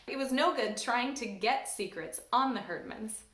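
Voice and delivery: usual speaking voice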